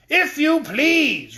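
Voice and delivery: high, very slow polite squeak; prim paper soldier. A narrator's reading. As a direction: draw it out primly. End soldier answers Dorothy.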